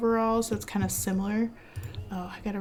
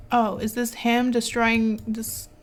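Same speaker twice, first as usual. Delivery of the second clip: normal talking voice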